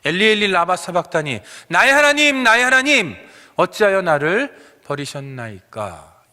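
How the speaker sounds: loud, high anguished cry to God. Acted. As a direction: cry out to heaven at full force. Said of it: "소리를 지르고 계시다라는".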